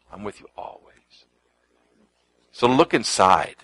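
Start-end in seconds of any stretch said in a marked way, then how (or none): 0.1-1.3 soft breathy whisper; whispered promise. Acted. Preacher whispering Christ's promise to the congregation. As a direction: whisper it like a secret comfort.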